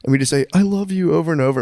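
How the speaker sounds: lightly voiced, sappy religion teacher; imitating the teacher. Student retells the religion teacher's morning ritual, mock earnest. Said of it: "he'd be like".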